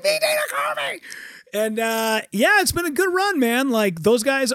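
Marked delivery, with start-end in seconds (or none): 0.0-1.0 much higher, gleeful shout; excited shout. Retold or imitated. Confirming to his brother-in-law, high excited shout. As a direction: yell the name like a fanboy.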